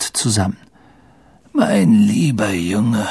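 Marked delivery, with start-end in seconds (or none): 1.5-3.1 put-on slow, frail, friendly old man; kindly old headmaster. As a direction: address the pupil gently and slowly. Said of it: "sagte Dippet freundlich". (freundlicher, gebrechlicher Greis)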